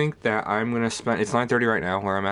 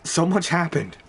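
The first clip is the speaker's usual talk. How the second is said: surprised; 10 semitones higher, slower than usual. Real, not acted. Overwhelmed by game exposition.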